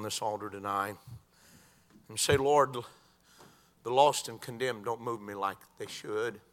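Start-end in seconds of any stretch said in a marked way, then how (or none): none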